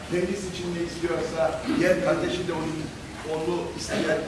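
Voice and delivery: prayer. Acted. Closing prayer for the audience.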